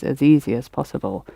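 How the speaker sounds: ordinary talk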